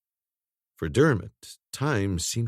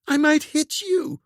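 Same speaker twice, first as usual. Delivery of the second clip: high, fearful voice; hesitant in fear; frightened protest; 3 dB louder than usual. A narrator's reading. Corinne frightened.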